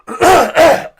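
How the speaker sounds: sudden harsh cough; coughing fit. Real, not acted. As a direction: cough hard mid-sentence. Cough, something stuck in throat.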